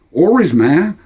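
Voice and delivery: put-on high, curious young boy; eager kid voice. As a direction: ask it wide-eyed. Boy adds.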